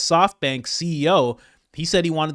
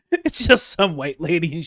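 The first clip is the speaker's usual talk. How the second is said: laughing while talking; 3 semitones higher, 5 dB louder than usual. Real, not acted. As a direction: voice cracking with real laughter. Cracking up at the image.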